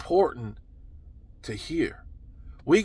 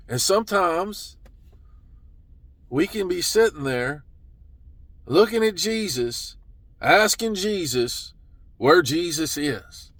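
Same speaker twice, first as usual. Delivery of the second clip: usual speaking voice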